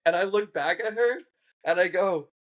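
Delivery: usual speaking voice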